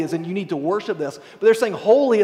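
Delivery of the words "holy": raised, louder warning cry; warning call. Acted. Preacher voicing seraphs warning Isaiah, a raised call. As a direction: call it out like an alarm. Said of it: "as a warning".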